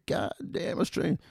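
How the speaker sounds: hammed-up American barfly voice; American accent. An American bloke in a bar greets the Aussie, in a mock American accent. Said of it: "He said".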